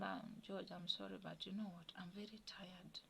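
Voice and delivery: soft, hushed reply; quiet whispered refusal; retold tired whisper. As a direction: whisper back wearily. Replying in whisper to secret plan.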